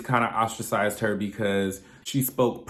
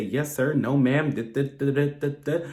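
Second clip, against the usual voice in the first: polite phone voice; 4 semitones higher than usual. Retold or imitated. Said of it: "make sure you say".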